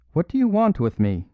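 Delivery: high, loud defiant outcry; cry out. A narrator's reading. Irish officer confronting Valentin at the door, high loud outcry. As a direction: fling the question out high and loud. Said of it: "he cried".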